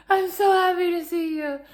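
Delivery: high, loud, gushing relief; overjoyed. Retold or imitated. Relieved traveller gushing at the rental clerk, high and loud. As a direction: gush at him like a long-lost friend. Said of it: "I probably frightened this young man".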